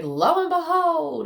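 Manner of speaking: hammed-up lo and behold; theatrical announcement. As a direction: proclaim it like a town crier. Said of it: "coming through as a theatrical thing".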